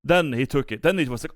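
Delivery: usual speaking voice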